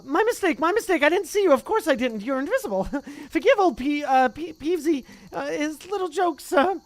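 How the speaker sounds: high, loud oily apology; greasy grovelling poltergeist. Acted. Peeves grovelling before the Bloody Baron, high loud oily voice.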